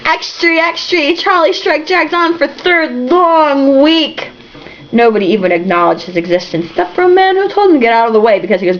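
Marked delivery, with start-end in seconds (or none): 0.1-4.3 high, slow newsboy call; calling headline. Acted. [name] calling the headline into the crowd, high newsboy call. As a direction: hawk it to the crowd.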